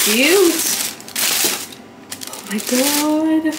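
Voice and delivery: high, slow, delighted oh my God; excited squeal. Real, not acted. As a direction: gush over them, slowly. Seeing the loafers.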